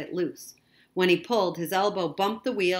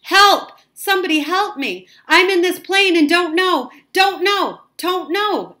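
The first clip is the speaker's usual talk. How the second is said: very high, loud cries for help; frantic, high-pitched shouting for rescue; panicked scream; 13 semitones higher, 14 dB louder than usual. A narrator's reading. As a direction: scream into the microphone in rising terror. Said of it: "began to scream into the microphone".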